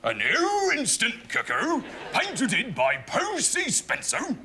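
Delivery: high, shouted cartoon dog voice, dragging the end out; Astro Pup voice. Asked by the host, reading a quiz clue as his storybook dog, high and shouting. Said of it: "read the top clue in the voice of Astro Pup".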